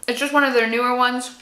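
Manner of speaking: talking while chewing chocolate; muffled speech through food; mouth full, chewing. Real, not acted. Seen 2: Bites chocolate at -1; chews with closed mouth until ~2.7, then speaks clearly.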